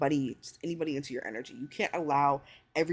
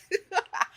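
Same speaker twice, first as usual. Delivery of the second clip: high laugh; 13 semitones higher than usual. Real, not acted. Laughing at herself.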